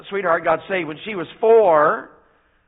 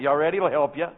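ordinary talk, nothing marked

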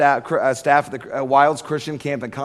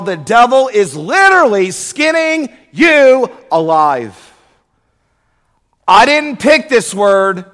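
preaching at full force; 4 semitones higher, 6 dB louder than usual. Acted. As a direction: hammer the warning home to the crowd. Sermon application.

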